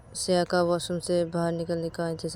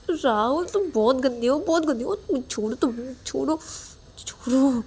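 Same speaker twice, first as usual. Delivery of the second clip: teasing character voice; 8 semitones higher than usual. Acted.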